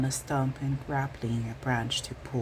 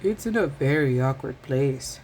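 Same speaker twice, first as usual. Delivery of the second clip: muttering; 4 dB louder than usual. A narrator's reading. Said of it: "muttered Hedgehog".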